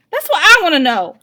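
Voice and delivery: high, loud scolding mother. A narrator's reading. Mother scolding her kids, loud and high. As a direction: pile on the scolding loudly.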